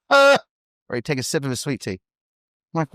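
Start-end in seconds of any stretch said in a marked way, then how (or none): 0.1-0.4 a sharp high "Ah!", loud and short; mock grunt of disapproval. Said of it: "That's all he'd do".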